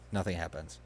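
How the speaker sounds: ordinary talk, nothing marked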